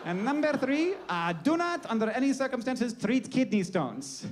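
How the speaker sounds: hammed-up foreign-accented decree; Hippocrates. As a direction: proclaim it like a comic ancient lawgiver. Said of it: "this is Hippocrates".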